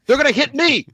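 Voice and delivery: high-pitched mock exasperation; exasperated scolding. Man scolding his imagined girlfriend, high exasperated protest. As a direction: scold her with the pitch shooting up.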